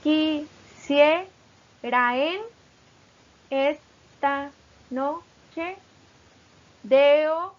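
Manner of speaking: very slow, broken into syllables with loud hits; slow, loud syllable-by-syllable dictation of a verse. Shown as a demonstration. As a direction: chop the line into separate syllables for counting. Teacher spells verse into syllables.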